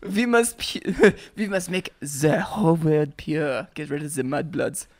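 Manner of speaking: slow mock-German villain; drawn-out, hammed-up German accent. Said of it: "Harry Potter Nazis?".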